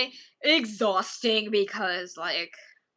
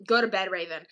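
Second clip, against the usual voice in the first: usual speaking voice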